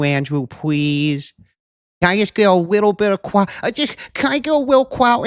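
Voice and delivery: high, whiny pleading voice; baby-voiced begging. Mocking Brian begging Andrew for clout, high whiny voice. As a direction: beg like a needy kid. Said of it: "with, like, two R's".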